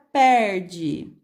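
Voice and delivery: "perde" slowly, stretching the R before the last syllable; R puxadinho. Shown as a demonstration. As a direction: draw out the R in "perde". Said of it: "a gente dá uma puxadinha". ("perde" bem devagar, com o R puxado)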